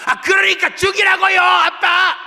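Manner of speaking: high, loud bratty scream; defiant child yell. Acted. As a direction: yell back at dad in a tantrum. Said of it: "순이야". (아빠에게 소리 지르는 아이, 높고 크게)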